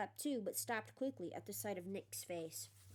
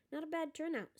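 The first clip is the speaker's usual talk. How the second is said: higher, mildly proud remark; 7 semitones higher than usual. A narrator's reading. Nick surveying his party guests, proudly. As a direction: show off a little, pitched up. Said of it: "said Nearly Headless Nick proudly".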